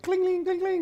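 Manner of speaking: high ringing imitation of weights clanking; ping out each cling like metal plates striking; sing-song imitation of iron clanging in a basement. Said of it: "I can hear the iron clanging around".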